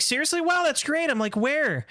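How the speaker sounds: high, gushing fake excitement; mock amazement. Teasing the graduates with fake amazement, high voice. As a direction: feign wide-eyed wonder.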